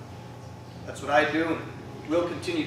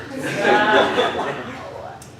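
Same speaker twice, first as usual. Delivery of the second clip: laughter; 7 semitones higher, 6 dB louder than usual. Real, not acted. Laughing after joke title.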